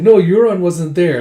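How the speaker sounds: loud, high correction; emphatic denial. Acted. Acted hasty denial.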